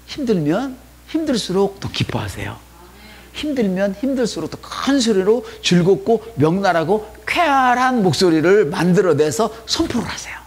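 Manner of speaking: rally the church to rejoice; exhorting. Real, not acted. Pastor urging members to rejoice aloud.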